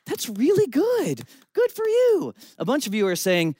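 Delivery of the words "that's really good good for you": high, sing-song mock congratulation; mock praise. Audience member voiced as mock-approving, sing-song. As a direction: chirp it like a condescending pat on the head. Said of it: "a lot of you are at home saying".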